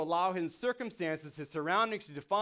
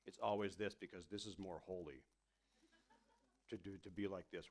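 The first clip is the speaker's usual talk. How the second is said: softer, much lower, solemn and grave; stoic Jesus; 11 semitones lower, 14 dB quieter than usual. Retold or imitated. Preacher mocks the stoic image of Jesus, low and solemn. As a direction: intone it like a stiff holy statue. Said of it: "we usually imagine Jesus really stoic".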